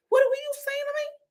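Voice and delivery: squeaky high mock Brooklyn-ish accent. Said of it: "where the hell are you from? The accent is all over the place".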